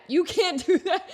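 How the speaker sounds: said through a laugh; laughing protest. Real, not acted. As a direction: protest while cracking up.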